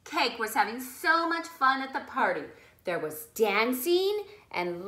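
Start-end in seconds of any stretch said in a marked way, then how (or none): none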